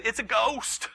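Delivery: high, loud mock shriek of fear; mock terrified cry. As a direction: cry it out like scared sailors.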